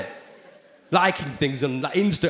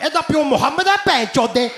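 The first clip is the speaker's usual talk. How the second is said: high, loud Punjabi taunt; Punjabi line; 11 semitones higher, 5 dB louder than usual. Retold or imitated. The joke line from the video, Punjabi taunt. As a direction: fire it off like a playground jibe. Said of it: "I'm like".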